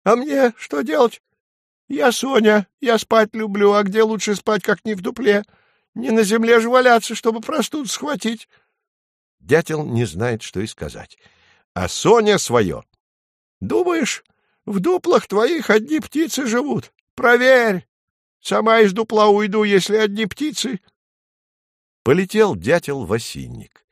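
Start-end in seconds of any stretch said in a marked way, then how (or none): 0.0-8.5 much higher, squeaky small-animal voice; a cheeky little dormouse pleading its case; tiny dormouse squeaking at the woodpecker. Acted. Dormouse character voice. 13.6-20.8 much higher, squeaky small-animal voice; a cheeky little dormouse pleading its case; tiny dormouse squeaking at the woodpecker. Acted. Dormouse character voice.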